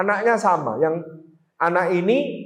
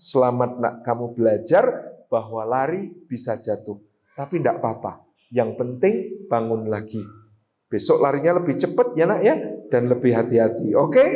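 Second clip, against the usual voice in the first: gentle comforting; 8 semitones lower than usual. Shown as a demonstration. Mother gently comforting child.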